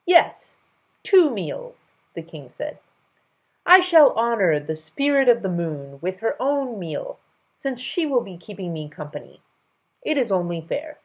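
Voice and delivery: loud royal king; firm king. Acted. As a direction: insist grandly.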